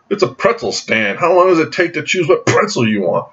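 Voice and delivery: impatient heckler. Retold or imitated. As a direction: act out someone fed up waiting behind. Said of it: "I hear this voice behind me go".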